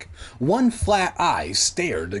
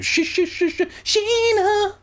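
squeaky, stretched mock stammer; comic stutter. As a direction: stutter up high, then stretch the name. Comic stuttered name in reply.

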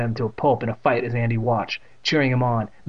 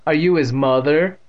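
snickering; 4 semitones higher, 6 dB louder than usual. A narrator's reading.

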